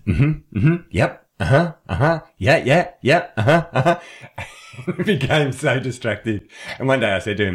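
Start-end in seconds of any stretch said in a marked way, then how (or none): 0.1-4.9 high, loud, rising interjections in a stream; exaggerated rapid-fire agreeing noises; over-eager listener. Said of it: "he listened like this". 4.9-6.5 high-pitched, laughing as you speak; slow words tumbling out of a laugh; amused, through real laughter. Real, not acted. Laughing after the imitation.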